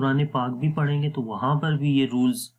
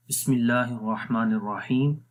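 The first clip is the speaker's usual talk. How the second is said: recitation. Shown as a demonstration. Teacher opens the Quran lesson, recited melodically. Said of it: "Quran teacher recites before lesson".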